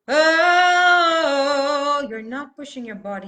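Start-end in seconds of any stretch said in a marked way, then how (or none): 0.1-2.1 high, very loud chanted oh; released anger. Shown as a demonstration. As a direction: release anger without pushing, one long vowel. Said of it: "release and express the anger".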